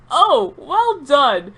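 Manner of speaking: slow, high and loud, breaking into laughter; can't get through it without laughing. Real, not acted. Reading absurd story, cracks up.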